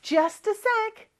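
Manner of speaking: Just a sec drawn out slowly, high; slow clear model. Shown as a demonstration. Teacher slowly models the clipped phrase. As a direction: model the short form slowly. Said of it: "줄임말 표현이라고".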